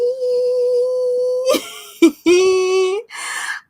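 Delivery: long, high squealing laugh; high-pitched giggle. Acted. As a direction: let out a drawn-out high giggle. Laughs after own rhyming boast.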